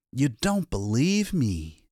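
lightly voiced, slow and flat-out tired; a hint of a weary accusing man. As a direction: Lois to the doubting commissioner, lightly voiced. Lois voiced in story.

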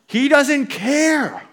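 high, loud, drawn-out mock wail; panicked wail. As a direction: cry it out in exaggerated panic. Acts out panicking disciples complaining.

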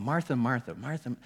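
muttered to himself. Retold or imitated. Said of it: "I kept going".

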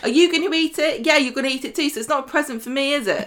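acting out a huffy partner; mock indignant. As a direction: scoff at a gift of cheese. Mock quarrel over a cheese present.

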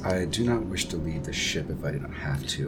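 hint of a low, soft reluctant voice; Sarah, reluctant. Sarah asks to stay with the ship, lightly voiced low reluctance. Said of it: "Sarah says".

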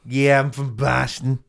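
slow, hammed-up Boston accent. Shown as a demonstration. Showing off the accent, slow broad Boston. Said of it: "why can I only say place names in the accent".

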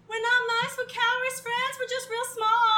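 high squeaky chipmunk voice; tiny loud squeaky voice. Acted. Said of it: "Except it's just chipmunk voice".

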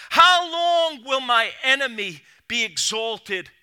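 high, loud lament, slowing into the later lines; crying out. Acted. Preacher reading David's psalm as a loud, slow lament. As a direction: read the psalm as a cry of anguish. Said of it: "David is crying out".

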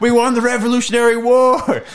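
high, loud gloating yell; hammed-up victory shout; mock patriotic boast. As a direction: crow it like a rowdy parade. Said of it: "bully the, the British guys".

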